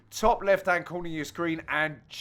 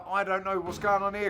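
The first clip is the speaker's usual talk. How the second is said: ordinary talk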